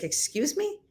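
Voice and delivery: high, soft incredulous question; disbelieving excuse me. Retold or imitated. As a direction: act out playful disbelief. Retold disbelief at the city.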